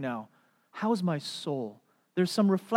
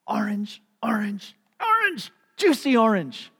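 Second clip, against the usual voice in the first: strained high, loud bursts, word by word; straining apple tree; 5 semitones higher, 6 dB louder than usual. Acted. Rotten tree trying to produce oranges, straining. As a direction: push each word out with effort, climbing to a squeal.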